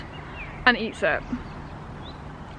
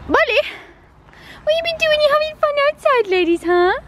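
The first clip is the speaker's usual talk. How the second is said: very high sing-song coo. Real, not acted. Greeting her dogs in very high baby talk.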